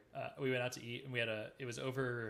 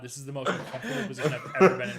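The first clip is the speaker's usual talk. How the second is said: louder, laughing through retold embarrassment; mortified laugh; 2 semitones higher, 13 dB louder than usual. Retelling his own mortified reaction at dinner, laughing loudly. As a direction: cringe and laugh at once. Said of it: "And I was like".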